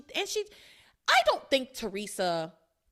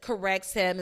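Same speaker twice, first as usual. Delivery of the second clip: ordinary delivery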